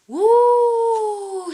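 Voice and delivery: high, loud cheering woo; excited woo. Acted. Little crocodiles cheer the museum trip, a loud high woo. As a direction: cheer with delight.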